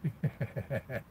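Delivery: brief, soft, rapid chuckle. Real, not acted. Streamer amused by a remark about politics, soft chuckle. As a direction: chuckle quietly to yourself.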